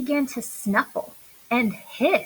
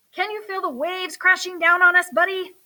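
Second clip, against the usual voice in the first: higher, dramatic call over waves; mock shout; 7 semitones higher, 3 dB louder than usual. A narrator's reading. Earl shouting to Buddy in the pretend storm, higher pitched. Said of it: "shouted Earl".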